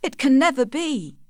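high, anguished refusal; crying out. A narrator's reading. Tess haunted by that night, crying out her refusal. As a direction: raise pitch sharply in an emphatic protest. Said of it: "cried Tess".

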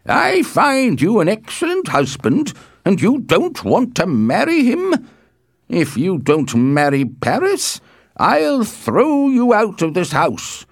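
loud, slow, high-pitched tirade; furious father, loudly hammering out threats; angry shouting. A narrator's reading. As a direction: rage as a father disowning his daughter. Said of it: "shouted Capulet angrily".

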